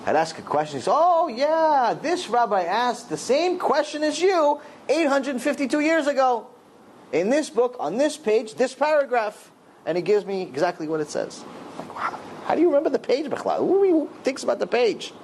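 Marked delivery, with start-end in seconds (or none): 0.9-9.5 high-pitched delighted recall, imitating a scholar; encouraging enthusiasm. Rabbi answering a question with exact sources, encouraging enthusiasm. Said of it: "he'd make me feel good about it". 11.9-15.1 high-pitched incredulous marvelling; amazed disbelief. Retold or imitated. Listener stunned that the rabbi remembers the page, amazed disbelief.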